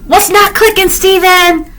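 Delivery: high-pitched, exasperated mock questioning; mock exasperation. Reader mocks a woman who doesn't get it, exasperated.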